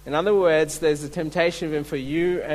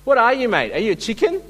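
higher, louder Aussie jeer, mate; Aussie taunt; 6 semitones higher, 4 dB louder, faster than usual. Retold or imitated. Kings mocking Gideon in Aussie slang, jeering Aussie accent. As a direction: taunt him like an Aussie bloke calling him chicken. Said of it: "kind of Aussie language".

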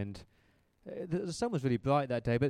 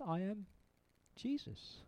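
hushed reveal; 6 semitones higher, 8 dB quieter than usual. Retold or imitated. Heavenly voice reveals itself.

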